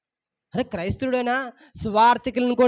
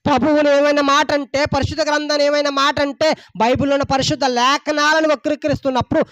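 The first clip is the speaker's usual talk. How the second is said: rising preacher's call, a bit louder; fervent preaching; 6 semitones higher, 4 dB louder than usual. Acted. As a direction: press the flock hard from the pulpit. Pastor preaching to congregation.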